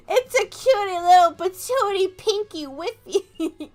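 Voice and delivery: put-on high, drawn-out baby talk; cutesy gushing. Streamer describing Igglybuff, cutesy gushing. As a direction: coo loudly as if over a baby. Gushes over the cute Igglybuff.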